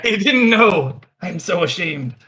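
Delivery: high, loud, hammed-up embarrassment; mock shame. Mock shame over teased pirate history.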